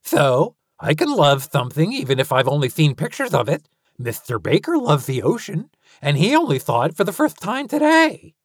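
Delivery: put-on high, loud, eager kid voice; excited child voice. A narrator's reading. Lucy voiced by narrator.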